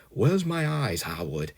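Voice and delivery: high-pitched mimicry of Bart's accent, falling away; imitated Bart accent. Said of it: "I do like his accent".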